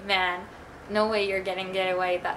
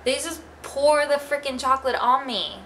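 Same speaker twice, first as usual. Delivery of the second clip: ordinary delivery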